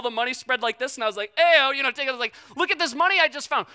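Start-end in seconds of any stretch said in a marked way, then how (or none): 1.4-1.7 high, loud call to buddies; excited hailing. Retold or imitated. Calling out to buddies, retold. 2.6-3.7 high-pitched, eager retold boast; excited showing off. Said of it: "I was so excited".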